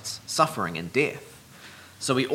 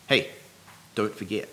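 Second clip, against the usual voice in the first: softer follow-up "Hey", pause, then the reminder; lightly acted parental nudge, dropping softer; attention-drawing reminder; 3 semitones lower, 4 dB quieter than usual. Parent repeating an instruction to children, a quiet reminder. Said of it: "drawing their attention to the importance".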